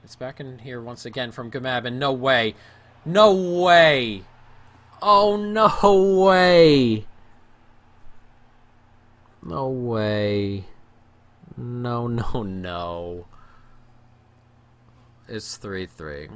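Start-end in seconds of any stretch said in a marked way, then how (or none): 1.8-13.3 loud, drawn-out no way; disbelieving shout. Real, not acted. Gamer conceding a last-second goal, shocked shout. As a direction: cry out in shock.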